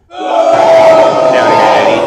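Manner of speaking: very high, loud, processed voice; distorted hell voice. Pretend. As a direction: call up from below like a voice effect. Voice from hell, effect.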